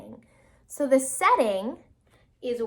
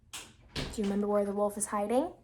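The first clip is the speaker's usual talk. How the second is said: ordinary delivery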